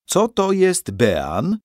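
high, very slow mock-solemn question; ceremony master. A narrator's reading. Master of ceremonies quizzes, high and slow. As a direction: draw it out like a ritual.

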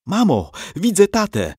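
put-on high, thrilled child's cry; excited boy's yell. A narrator's reading. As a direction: yell to your mother in sudden joy. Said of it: "wrzasnął Johann".

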